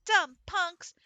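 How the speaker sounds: loud, high-pitched insult; angry yell. Acted. Girl picked on by pigeons in the park, angry yell. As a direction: yell it at a gang of bullies.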